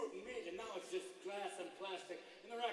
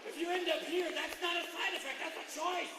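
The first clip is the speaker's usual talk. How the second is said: loud, raised indignant shout; mock outrage; 9 semitones higher, 6 dB louder than usual. Comic objecting to the listed side effects, loud outrage.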